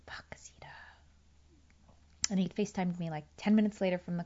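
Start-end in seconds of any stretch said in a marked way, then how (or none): 0.1-0.7 whisper. Retold or imitated. Scolding herself.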